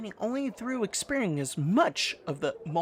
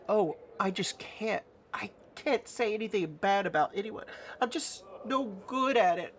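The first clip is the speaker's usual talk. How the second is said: higher-pitched, reluctant sweet voice; timid Nanny; 5 semitones higher, 4 dB quieter than usual. Acted. Nanny tells player she can't badmouth anyone, higher and timid. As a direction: plead that you simply can't do it. Timid character refuses to speak ill.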